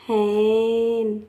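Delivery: हैन stretched very slowly, vowel held long; slow spelled-out हैन; drawn-out teaching demo. Shown as a demonstration. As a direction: sound out हैन slowly for children. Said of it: "हैन मतलब मुर्गी".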